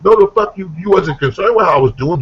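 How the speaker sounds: high, heated mock argument; indignant retort. Stabbed victim answering the offender, high indignant retort. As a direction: fire back in acted outrage.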